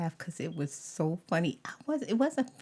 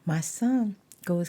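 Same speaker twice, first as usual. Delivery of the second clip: usual speaking voice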